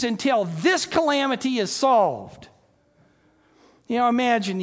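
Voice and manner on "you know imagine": ordinary talk, nothing marked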